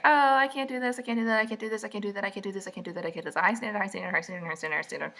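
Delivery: rapid looping chant, sinking and fading; mock rambling complaint. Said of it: "and be like".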